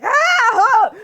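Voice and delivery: high loud yelp of panic; startled scream. Acted. Player yelping as she flees the attacker. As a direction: shriek while running away.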